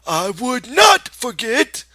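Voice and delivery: high, loud, slow protest. Acted. Lenny protests to the rabbit, loud and slow. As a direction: insist at full voice. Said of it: "Lenny said loudly".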